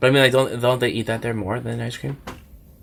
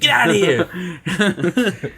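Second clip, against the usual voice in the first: mock angry owner; 6 semitones higher, much slower than usual. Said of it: "He's like".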